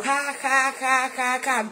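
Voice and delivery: deliberate, level "ha ha ha" at the devil; mock laugh. Preacher laughing at the devil, mock laugh. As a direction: laugh mockingly, each ha spelt out. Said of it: "Laugh the devil".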